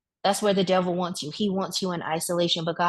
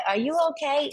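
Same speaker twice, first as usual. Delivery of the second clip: concerned lady; 9 semitones higher than usual. Retold or imitated. Stranger lady asks concerned.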